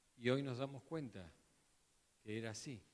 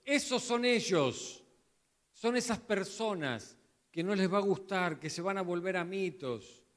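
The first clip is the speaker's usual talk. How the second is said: fervent preaching; 5 semitones higher, 5 dB louder than usual. Acted. Preacher voicing Paul warning Timothy, fervent. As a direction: thunder Paul's warning about them.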